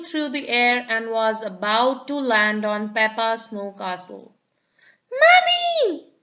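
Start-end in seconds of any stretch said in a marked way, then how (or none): 5.2-6.1 high, loud alarmed call; shout. A narrator's reading. Peppa shouts for Mummy to save her snow castle. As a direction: yell for help before the ball hits. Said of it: "shouted Peppa".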